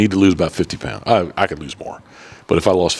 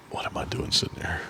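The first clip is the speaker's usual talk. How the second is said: retold inner thought; 3 semitones lower, 14 dB quieter than usual.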